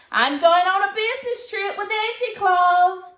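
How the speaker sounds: high and loud, bursting with news; a girl's loud eager news; excited announcement. Acted. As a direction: blurt it out thrilled. Sophie announcing to family.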